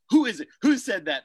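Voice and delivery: playfully demanding who said it; mock alarmed demand. Host voicing teacher asking who mocked him, mock loud. Teacher reacting to fan's comment.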